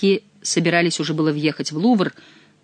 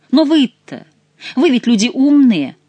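loud, higher irony; ironic retort; 8 semitones higher, 7 dB louder, slower than usual. Acted. Chicot ironically jabbing the courtiers, loud. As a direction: jab at their cleverness.

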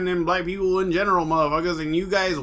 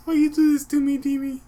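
much higher, wailing mock spirit; mock ghost voice; 10 semitones higher than usual. Friend mocks a dead fighter's ghost, high and whiny. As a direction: whine like a ghost calling a friend.

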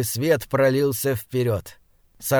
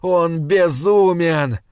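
louder, slow anguished cry; moaning lament; 3 semitones higher, 5 dB louder, much slower than usual. A narrator's reading. Joshua despairs of his brother, anguished moan. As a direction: draw the words out in despair. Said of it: "стонал Джошуа".

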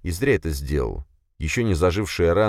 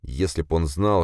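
plain reading voice

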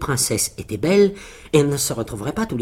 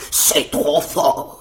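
slow, drawn-out cry of outrage; outraged giant's cry; much slower than usual. Acted. Giant hit by a big stone, crying out in outrage. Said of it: "s'écria celui-ci".

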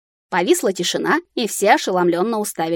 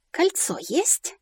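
тихий высокий протяжный вопрос; спроси с угрожающей лаской, очень медленно; ласковый рык; 8 semitones higher, 7 dB quieter, much slower than usual. A narrator's reading. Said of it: "ласково прорычала я". (обманчиво ласково, нараспев)

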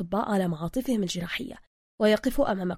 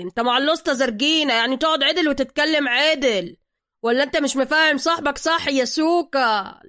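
higher, louder threatening rant; yelling; 4 semitones higher, 7 dB louder than usual. Acted. Zergina yelling at the rude client, higher and louder. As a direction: shout him back into his seat. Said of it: "وقف زرجينا زاعقا".

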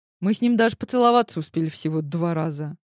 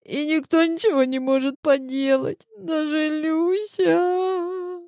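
choking on sobs; 10 semitones higher than usual. A narrator's reading. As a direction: complain while sobbing, pitched way up. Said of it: "захлёбываясь рыданиями".

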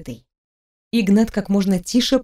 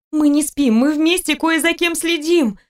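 higher, insistent female character; urgent persuading; 7 semitones higher than usual. A narrator's reading. Wife trying to convince her husband, urgent plea. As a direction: insist high, slowing at the end. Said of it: "Снова попыталась убедить его она".

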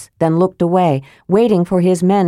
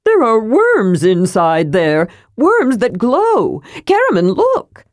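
a curious kender thrilled by what he's found; bursting with delight, calling to Karaman; sudden excitement; 4 semitones higher, slower than usual. Acted. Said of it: "said suddenly".

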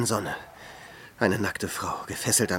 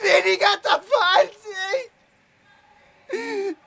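much higher, much louder, laughing then sobbing cries; frenzied crying shout; 6 dB louder than usual. Acted. Hermit boasting of his deeds until he breaks into sobbing shouts. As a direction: build from manic laughter into a broken howl.

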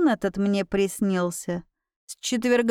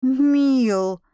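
reproachful call; 2 semitones higher, 4 dB louder than usual. A narrator's reading. Repeated reproachful calls.

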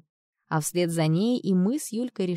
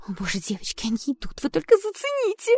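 excited whisper; 11 semitones higher than usual. Acted. Whispering as boys arrive.

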